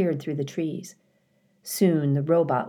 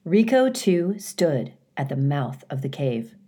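ordinary delivery, nothing marked

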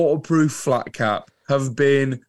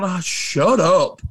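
exasperated, raised pitch grumble; grumpy shut up; 5 semitones higher than usual. Retold or imitated. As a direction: groan it at the radio like it's too soon. Annoyed at early Christmas songs.

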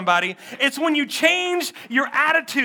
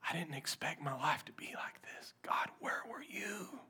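whispered lament; 8 semitones lower, 21 dB quieter than usual. Acted. Grieving believer whispering to God, whispered lament.

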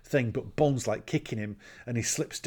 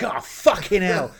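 retold alarmed reaction; 6 semitones higher, 7 dB louder than usual. Retold reaction to pulled gun.

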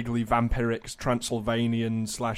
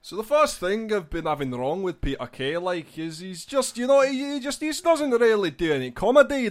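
high-pitched Scottish accent, building louder into a mocking rant; Frankie Boyle; 8 semitones higher, 6 dB louder than usual. Contestant arguing for a rival comic's hanging, high Scottish impression. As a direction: rant in a raised, lilting Scottish comedian's voice. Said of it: "in the style of Frankie Boyle".

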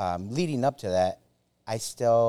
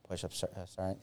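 sheepish reply; 13 dB quieter than usual. Retold or imitated. Answering sergeant sheepishly.

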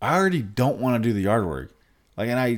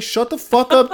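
much higher, loud angry snap; angry yell; 14 semitones higher, 6 dB louder than usual. Retold or imitated. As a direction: tell them off full blast. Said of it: "I'd be like".

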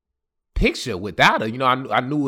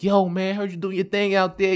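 raised, lively homie voice; a touch higher, hyped-up friend; homies greeting; 7 semitones higher than usual. Retold or imitated. Homies greeting him, acted quote.

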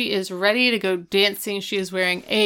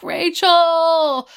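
high, loud cry of a name; calling out; 10 semitones higher, 6 dB louder than usual. Retold or imitated. Eric crying out for his lost love, high and loud. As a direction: call the name out in longing.

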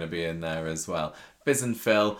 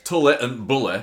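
louder, broad northern English vowels; northern English accent; 3 semitones higher, 6 dB louder than usual. Shown as a demonstration. Showing co-host the rhyme works, in a northern accent. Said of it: "if you say it in a northern accent".

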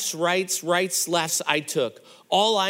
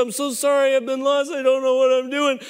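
sobbing apology; 6 semitones higher, 4 dB louder than usual. Retold or imitated. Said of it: "I just lose it".

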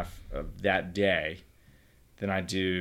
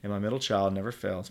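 ordinary talk, nothing marked

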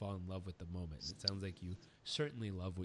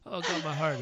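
laughing; 7 semitones higher, 10 dB louder than usual. Retold or imitated. Quoting himself moved, laughing.

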